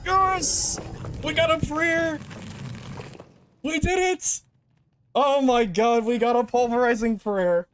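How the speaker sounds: overjoyed, can't believe the luck; giddy relief. Real, not acted. Rare loot drop after escape.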